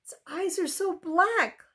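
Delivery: understated, acted child's sob; a touch of a child's tearful plaint; sobbing. A narrator's reading. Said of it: "Laura sobbed".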